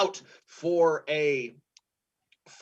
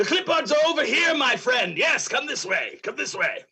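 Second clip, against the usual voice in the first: higher, quick calling-out voice; put-on higher voice, ushering someone along; eager helper character; 6 semitones higher than usual. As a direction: beckon a friend over, raised and lively. Helper character calls them over.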